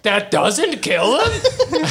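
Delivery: high, slow, shocked question; amazed disbelief with a laugh; surprised. Retold or imitated. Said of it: "And Alyssa's like".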